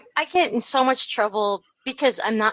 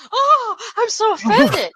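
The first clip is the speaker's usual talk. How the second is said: mock offended; 10 semitones higher, 4 dB louder, slower than usual. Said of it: "And he's like".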